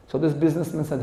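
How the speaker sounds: usual speaking voice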